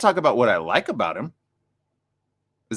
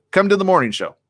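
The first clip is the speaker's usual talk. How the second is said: louder, emphatic invite; 8 semitones higher, 4 dB louder than usual. Shown as a demonstration. Host challenging Trump to come on the show, emphatic. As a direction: repeat "Come to the morning show" with more push, louder and higher. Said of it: "That's my invite".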